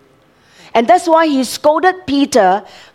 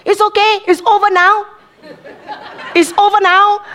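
high, chirpy reassurance with a laugh; sing-song comfort for a crying child; the nurse after the jab, brightly soothing; 9 semitones higher than usual. Retold or imitated. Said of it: "the person who gave the jab says".

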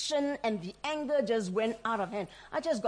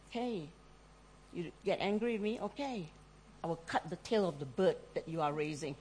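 quiet passive threat; 6 semitones lower, 6 dB quieter than usual. Retold or imitated. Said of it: "they were doing this a lot".

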